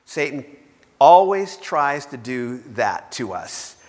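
ordinary talk

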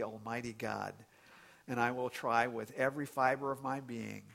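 soft, hushed reverent prayer; closing prayer. Real, not acted. Pastor leading the congregation in prayer, quiet and reverent. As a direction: pray gently to the Lord.

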